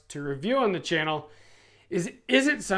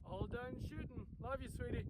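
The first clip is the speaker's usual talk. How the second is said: soft whisper into radio; 6 semitones higher, 21 dB quieter than usual. Real, not acted. Dad answers softly into radio.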